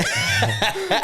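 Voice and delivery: high burst of laughter. Real, not acted. Cracking up at the banter.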